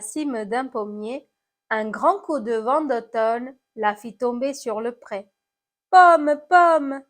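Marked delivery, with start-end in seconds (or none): 5.9-7.0 loud, higher call to the apple. Acted. Someone calls to the fallen apple, calling out. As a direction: call out with alarm, pitch raised.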